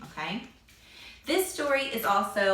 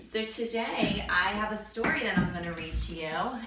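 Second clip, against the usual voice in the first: ordinary delivery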